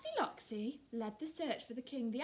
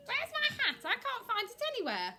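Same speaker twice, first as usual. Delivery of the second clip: high, loud little chick voice; squeaky-pitched, clueless chick; silly chick; 12 semitones higher, 6 dB louder than usual. Acted. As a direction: play a baffled baby chick.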